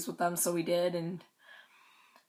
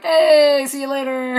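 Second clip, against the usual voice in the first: mock calling out; 7 semitones higher, 11 dB louder than usual. Imitating guys passing on the street, mock calling out. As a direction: imitate a breezy shout in passing.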